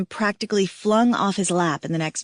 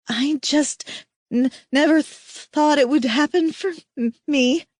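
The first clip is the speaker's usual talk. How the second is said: stammering through tears, broken words; tearful babbling; 7 semitones higher than usual. Acted. Kira to her mate, babbling tearfully through stutters. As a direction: choke out the words, halting and higher. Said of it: "I babble".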